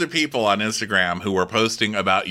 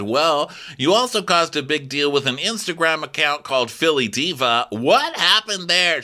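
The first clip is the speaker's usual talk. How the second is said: high, punchy interviewer voice; mock host grilling; Andy; 8 semitones higher, 3 dB louder than usual. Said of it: "Andy said".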